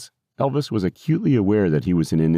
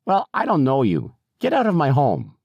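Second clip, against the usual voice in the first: put-on higher-pitched, indignant old lady; indignant resident, pitched up; objecting old woman; 8 semitones higher than usual. A narrator's reading. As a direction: order the stranger out in an old-lady voice. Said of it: "she got louder and louder".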